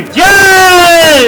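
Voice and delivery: crowd roaring the reply; shouted response. Real, not acted. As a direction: shout it back as one crowd. Crowd answers rally chant. (ज़ोर से जवाबी नारा)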